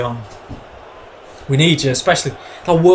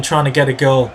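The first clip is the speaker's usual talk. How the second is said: ordinary delivery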